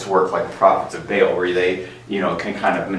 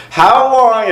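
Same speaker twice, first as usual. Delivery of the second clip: higher and louder, insistent; repeated louder; 5 dB louder than usual. Retold or imitated. Sister on the telethon repeats the question louder. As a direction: demand it again, louder. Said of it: "just repeats it louder".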